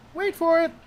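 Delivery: high-pitched teasing aside; playful high-voiced build-up; teasing suspense. Real, not acted. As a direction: pitch it up high, holding back the punchline. Teasing pause before the punchline.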